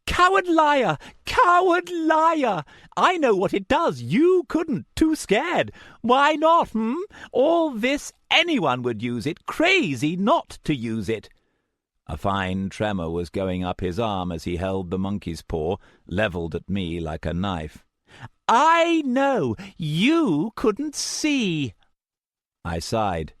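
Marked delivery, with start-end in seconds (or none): 0.1-11.3 high, loud, unhinged taunting; wild voice. A narrator's reading. Madman taunting the narrator: wild high shouting. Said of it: "his voice wild". 18.5-21.7 high, loud, unhinged taunting; wild voice. A narrator's reading. Madman taunting the narrator: wild high shouting.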